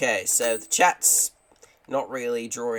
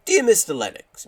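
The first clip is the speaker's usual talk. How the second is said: higher, louder acted letter opening; hammed-up formal salutation, pitched up; put-on letter voice; 7 semitones higher, 7 dB louder than usual. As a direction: announce the letter in a put-on character voice. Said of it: "do some decent voices for this".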